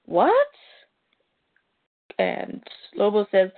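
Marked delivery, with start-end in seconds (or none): 0.1-0.5 sharp high shocked question; shocked what. Retold or imitated. Clark reacting to Lobo's news, high startled what. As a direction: Clark caught off guard, voice jumping up. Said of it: "And Clark is like".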